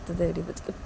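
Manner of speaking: plain reading voice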